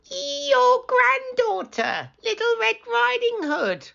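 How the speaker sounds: wolf pretending to be a girl; wolf squeaking. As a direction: fake a little girl's voice. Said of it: "squeaked the wolf".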